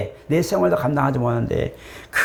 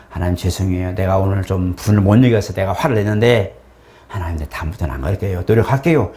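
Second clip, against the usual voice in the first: repentant prayer demo; 5 semitones lower than usual. Shown as a demonstration. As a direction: confess humbly and low. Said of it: "이게 뭐냐? 회개해요".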